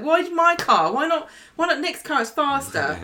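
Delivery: high, indignant mock whine; acted-out high-pitched protest. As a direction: plead it, pitched well up. Acting David pleading with captor.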